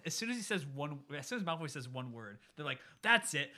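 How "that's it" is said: high, loud mock snap of temper; mock outburst. Imitating Crabbe and Goyle losing their temper at Malfoy, loud mock outburst. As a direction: blow up like a hothead who can't take an insult.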